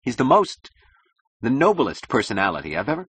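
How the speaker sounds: stung, halting insistence; angry defence. Acted. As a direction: defend your idol, faltering mid-sentence. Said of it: "he said angrily".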